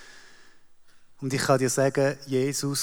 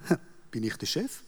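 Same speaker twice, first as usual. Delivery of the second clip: usual speaking voice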